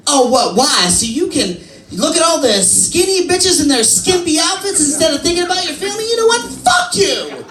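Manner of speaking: mock indignant rant, climbing in pitch; indignant wife. Wife lashes out at husband over a park suggestion, indignant.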